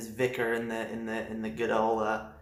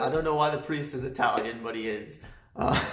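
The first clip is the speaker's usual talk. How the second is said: normal talking voice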